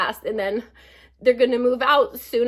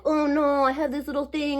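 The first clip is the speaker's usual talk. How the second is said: higher-pitched mock poor-me complaint; mock weak; 5 semitones higher than usual. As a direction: put on a weak little "oh no". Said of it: "feels like I'm weak".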